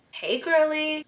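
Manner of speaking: put-on high girly greeting; very friendly. Shown as a demonstration. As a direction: greet her like a gal pal, voice up. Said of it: "It comes across very friendly".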